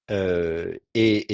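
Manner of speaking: normal talking voice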